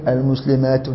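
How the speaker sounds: المسلمات wrongly ended with an added "-un" sound; incorrect tanween. Shown as a demonstration. Teacher showing what not to say in sound feminine plural, incorrect tanween. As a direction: sound out المسلمات with a final n after the article. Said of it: "وما تقولش".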